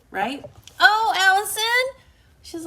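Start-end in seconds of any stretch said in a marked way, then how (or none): 0.8-2.0 high, loud, drawn-out delighted cry; delighted greeting. Real, not acted. Host spotting Allison in the live comments, thrilled. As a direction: burst out happily at seeing a friend. Greeting a viewer who just appeared.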